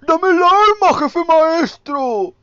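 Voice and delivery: high, loud, slow Castilian-dub shout; mock Spanish dub voice. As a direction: bellow like the Spanish dub of the game. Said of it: "Con acento español, como el juego". (imitando el doblaje español a gritos)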